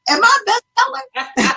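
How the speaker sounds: very high, drawn-out squeal of disbelief; mock astonishment. Author finding that people have her book, mock astonishment. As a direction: act amazed, pitch shooting right up. Acts out her surprised reaction.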